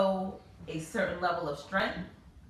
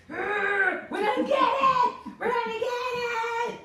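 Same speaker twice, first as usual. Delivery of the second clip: much higher and louder slow war cry; battle scream; 6 dB louder than usual. Retold or imitated. Mother rallying herself against the water bug, shouting. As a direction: charge at the bug like a warrior. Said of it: "I started screaming".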